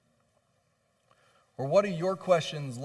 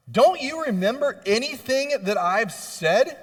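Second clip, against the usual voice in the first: higher, louder exasperated scolding; mock scolding; 7 semitones higher, 5 dB louder than usual. Jesus imagined scolding disciples, higher louder exasperation. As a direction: scold them like a fed-up teacher. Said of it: "He doesn't scold them".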